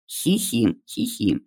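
soft unvoiced schoolgirl giggle; breathy hee-hee, barely voiced; giggling. A pupil in the online lesson, quiet giggle.

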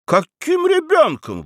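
very high, drawn-out protest; indignant. A narrator's reading. Grown son objecting to being called a child, indignant. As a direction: object with pitch shooting up. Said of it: "возмутился Феофил".

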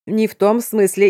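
быстро, сдерживая смех; оправдывайся, давясь смехом; тараторя со смехом. A narrator's reading. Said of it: "затараторил Марк, еле сдерживая смех". (скороговорка сквозь смех)

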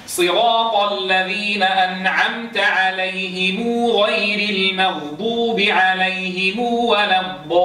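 slow recitation with عليهم joined by a long u; slow Quranic reading with the mim joined by waw; Qalun reading. Shown as a demonstration. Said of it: "فينطقونها".

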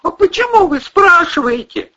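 high-pitched, puzzled question; bewildered. A narrator's reading. Captive girl questioning the men, bewildered. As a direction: ask it loud and high in bewilderment. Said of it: "в её голосе проступило недоумение".

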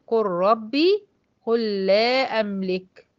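slow, lam merged into ra and lam; slow Quranic recitation; recitation with idgham. Shown as a demonstration. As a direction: recite slowly, both merged. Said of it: "والأمثلة قدامي".

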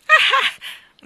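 put-on high shrill old crone cackle. Old shopkeeper greets children with a shrill cackle. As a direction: cackle high and loud like an ancient woman.

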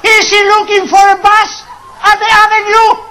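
usual speaking voice

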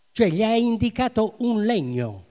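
loud, ringing preacher's announcement; loud proclamation. Acted. Preacher announces to the congregation, loud and ringing. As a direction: proclaim it to the whole church.

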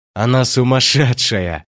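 ordinary delivery

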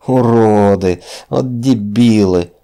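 raging. A narrator's reading. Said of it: "бесновался Хрюнов".

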